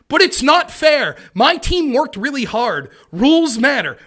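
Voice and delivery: high, loud, slow complaint; mock indignant protest. Imitating a coach after his team loses, indignant. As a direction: hammer out each grievance, imitating a sore loser.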